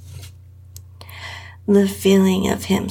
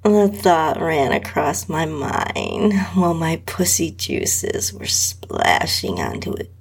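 ordinary delivery, nothing marked

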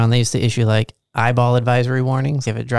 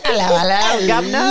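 higher-pitched, laughing Cockney imitation; mock Cockney accent; 6 semitones higher than usual. Co-host mimics the host's greeting, mock Cockney accent. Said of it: "like, a Cockney accent".

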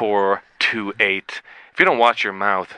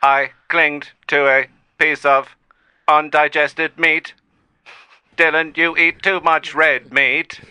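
slow, higher-pitched machine voice; robotic survivor; 8 semitones higher, 7 dB louder, slower than usual. Pretend. AI explaining its survival to Dylan, slow robotic. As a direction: recount it word by word like a robot.

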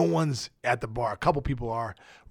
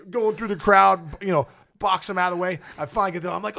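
normal talking voice

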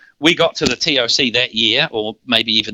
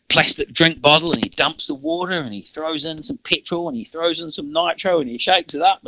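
ordinary talk, nothing marked

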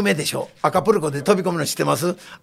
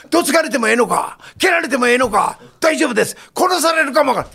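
mock boss challenge; 7 semitones higher than usual. Boss questions, eager answer.

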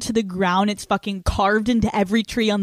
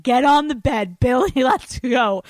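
mock dominant order; 4 semitones higher, 6 dB louder than usual. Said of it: "being like".

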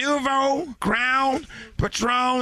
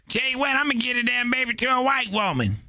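ordinary delivery

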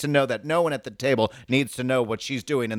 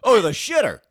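loud crude retort; 10 semitones higher, 6 dB louder than usual. Retold or imitated. Retorting character in a skit.

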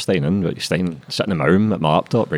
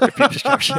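high, mocking repeat of the title; mocking own title. Mocking the old title he'd chosen. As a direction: say Repeat Prescription like it's a joke.

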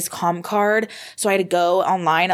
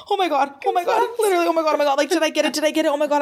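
high-pitched, tumbling mock disbelief; retold freaking out; 10 semitones higher, much faster than usual. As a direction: replay the freak-out, repeating in a high voice. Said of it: "I'm, like, freaking out".

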